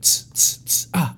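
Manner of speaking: quick hissed tss noises for each kick; kick sound effects. Retold or imitated. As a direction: spit out little tss sounds like strikes landing. Imitating rapid kicks to thigh.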